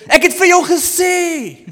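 sudden high, loud shout; wife yelling at her husband. Retold or imitated. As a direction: yell it at him in frustration. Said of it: "Dan skree hulle harder".

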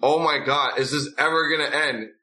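usual speaking voice

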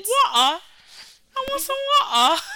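high laughing whiny beg; pleading orphan. Pretend. Cohost plays a Dickensian orphan begging, high laughing whine. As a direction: beg for water like a starving child, cracking up.

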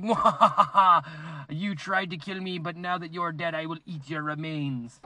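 gloating villain with evil laugh; slow, menacing villain gloat; slow theatrical villain menace. Pretend. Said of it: "to be like".

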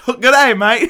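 loud, high mock Australian greeting; bad Australian accent. Terminator trying to be Australian, loud fake Australian accent. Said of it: "trying to also be Australian".

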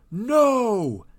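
loud, high, drawn-out cry of protest; king's anguished yell. Acted. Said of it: "yelled King Merida".

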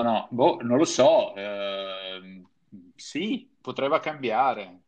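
ordinary delivery

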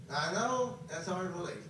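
normal talking voice

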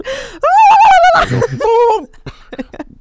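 high shrieking turkey gobble; gobbling turkey voice. Acted. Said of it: "I don't think they really sound like that".

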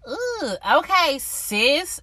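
drawn-out, teasing "Ooh, okay, sis"; sassy ooh okay. Acted. Podcaster reacting to the shade episode with sass. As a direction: savor it like juicy gossip, slow and loud.

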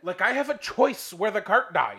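loud, high outraged retort; indignant sarcasm. Retold or imitated. Stranded golfer ranting about the marshal's blame, indignant. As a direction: rant back at an absurd accusation.

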